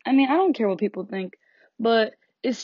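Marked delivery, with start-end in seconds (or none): none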